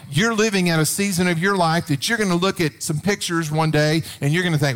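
ordinary talk

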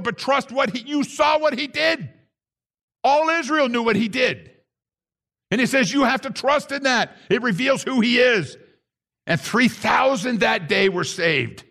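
high, ringing preacher's cry; fiery sermon delivery, high and driving; preaching at full force. Acted. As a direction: proclaim it to the whole room. Preaching about Yeshua's miracles.

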